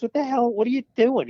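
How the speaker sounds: a dad who can't believe this is the spot; incredulous dad. Retold or imitated. Dad balks at the shabby cheesesteak place, incredulous and high.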